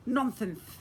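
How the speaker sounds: high, teasing grin in the voice; fairy teasing a wizard; grinning fairy. Acted. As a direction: tease him cheerfully. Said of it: "added, grinning".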